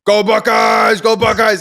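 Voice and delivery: high, loud mock fan chant; yelling the team cheer, mimicking a superfan; imitated fan screaming. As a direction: holler it like an obnoxious sports fan. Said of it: "every couple of minutes was screaming".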